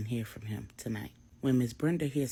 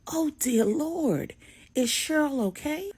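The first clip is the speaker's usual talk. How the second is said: put-on higher, slow alarmed old mother; elderly mother shocked by news of an attack; Miss Brenda, alarmed; 11 semitones higher, slower than usual. A narrator's reading. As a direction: draw out "Oh, dear Lord" in shock. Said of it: "Miss Brenda says".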